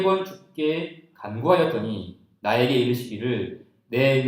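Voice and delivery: slow, measured unison-style reading; congregational scripture reading. Real, not acted. Preacher leading the congregation through the verse, reading aloud. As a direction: read the verse out slowly and deliberately. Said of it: "같이 한번 읽어볼까요?".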